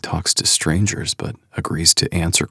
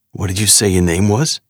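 put-on gruff New York accent; 3 semitones higher, 5 dB louder than usual. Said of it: "gruff New York accent".

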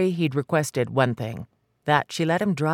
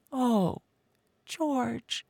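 high, soft, crying sigh of his name; tearful; 10 semitones higher, 4 dB quieter than usual. Acted. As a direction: let the tears break through. Mary gives way, crying.

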